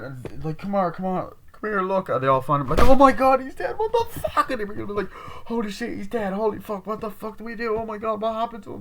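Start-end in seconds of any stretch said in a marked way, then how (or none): 0.6-2.1 high, urgent acted call; mock urgent calling. Finder calls the others to the body, mock urgency. 2.8-8.8 very high, panicky mock voice; hammed-up panic, pitched way up; acted panic. Friends all find him dead, hammed-up panic. Group panics over the body.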